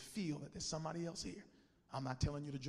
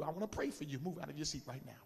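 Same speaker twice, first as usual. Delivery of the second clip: normal talking voice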